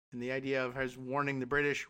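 usual speaking voice

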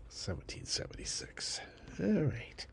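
normal talking voice

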